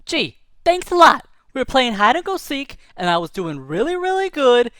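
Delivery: high, loud, sarcastic bird voice; annoyed hawk. Acted. Hawk on the ground scolding Ted, sarcastic and loud. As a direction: whine that the game got ruined.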